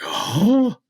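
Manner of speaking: awed gasp. Retold or imitated. Hunter reliving the rack emerging from fog, awed gasp. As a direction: act out sudden awe, pitch jumping up.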